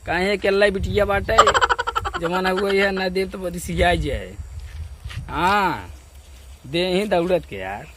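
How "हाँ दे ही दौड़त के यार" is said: normal talking voice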